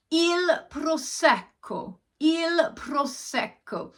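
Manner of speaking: hammed-up Italian "il prosecco", drawn out; Italian pronunciation. Shown as a demonstration. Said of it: "English people ... using your hands".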